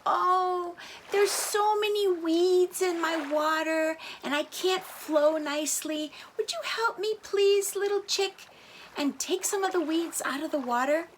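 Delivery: high, pleading river voice; a small river begging for help. A narrator's reading. River begging the little chick, high and pleading.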